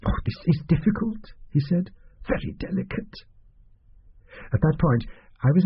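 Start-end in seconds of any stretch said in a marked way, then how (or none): 0.0-1.3 lightly voiced, higher, fretful little man; fussy little doctor. Narrator voices fussy fat doctor. 2.3-3.1 whispered aside; confiding hush. Acted. Doctor hints confidentially.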